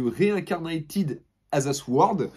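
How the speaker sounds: clumsy French-accented English title; English accent attempt. Real, not acted. Reading the manga's English title in a shaky English accent. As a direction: try the title in English and stumble. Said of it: "Mon accent anglais est nul".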